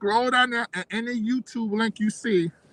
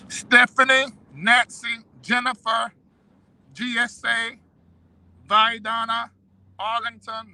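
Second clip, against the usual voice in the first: raised, ringing name call; announcer roll call; 5 semitones higher, slower than usual. Acted. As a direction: call each name out proudly. Reads out subscriber names.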